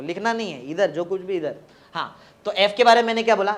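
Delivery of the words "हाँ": usual speaking voice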